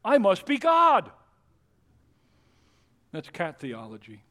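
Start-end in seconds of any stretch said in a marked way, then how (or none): 0.1-1.1 loud, high smug cat; hammed-up cat boasting. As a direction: crow it like a proud cat. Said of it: "A cat says".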